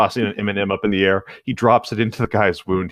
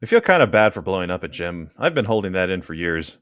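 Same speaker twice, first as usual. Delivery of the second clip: normal talking voice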